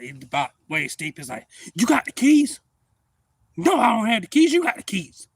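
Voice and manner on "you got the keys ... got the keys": raised, high-pitched demand for the keys; loud acted alarm. One hunting buddy asks another for the keys, loud alarm. Acting out a panicked exchange.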